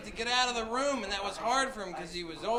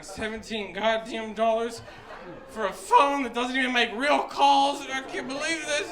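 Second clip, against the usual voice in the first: outraged grandfather; 3 semitones higher, 5 dB louder than usual. Retold or imitated. Grandfather at toy price in Kmart, hammed-up outrage. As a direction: stretch out the words in indignation. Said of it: "my grandfather loses his shit".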